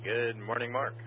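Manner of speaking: ordinary talk, nothing marked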